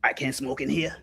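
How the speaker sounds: low, soft Columbo voice; a lightly voiced detective asking to smoke; Columbo impression. Preacher as Columbo asking Auntie to smoke, soft and low. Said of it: "like Columbo".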